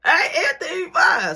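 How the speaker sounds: high, loud, laughing relief; loud, high, half-laughing self-reassurance; relieved inner voice. Retold or imitated. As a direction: talk yourself into feeling fine. So I'm thinking.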